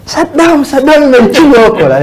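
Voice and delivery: high, loud mock scolding; imitated shouting. Waitress shouting at the new barista, imitated high-pitched yell. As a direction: yell it like an angry co-worker. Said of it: "She came shouting".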